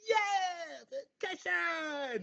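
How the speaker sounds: put-on very high squealing kid cheer; little ones thrilled, drawn out; excited otter pups.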